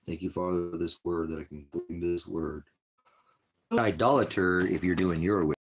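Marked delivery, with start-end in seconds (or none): 3.7-5.5 loud rebuke. Real, not acted. Preaching rebuke.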